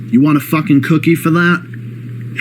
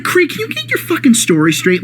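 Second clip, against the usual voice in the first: much higher, incredulous exasperation; exasperated outburst; 11 semitones higher than usual. Real, not acted. Creator venting at Creed over his contradictions, exasperated high outburst. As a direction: throw up your hands in disbelief.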